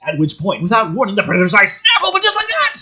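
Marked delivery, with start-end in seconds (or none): 1.1-2.7 high, loud and fast burst; dramatic reveal. Acted. Narrator hitting the shock moment hard. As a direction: slam the reveal home.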